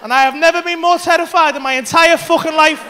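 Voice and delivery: ordinary talk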